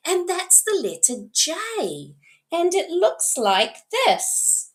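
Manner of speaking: encouraging early-years teacher lilt; teaching a child. Shown as a demonstration. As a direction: show a young child, swooping up and down. Modelling teaching talk to a child.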